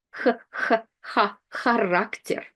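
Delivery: Х rasped at the back of the mouth, repeated, then характер; isolated Russian Х. Shown as a demonstration. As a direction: practise the Х alone before saying характер. Teacher isolates the first consonant.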